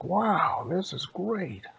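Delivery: loud, high wonder; pleased, high-pitched amazement; delighted bears. Acted. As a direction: marvel with a big high wow.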